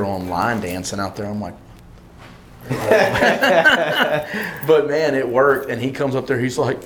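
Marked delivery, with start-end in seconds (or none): 2.6-4.6 high, louder burst of laughter; a real laugh of relief; delighted laugh. Real, not acted. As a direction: laugh out in happy disbelief. Laughing at line dancers' success.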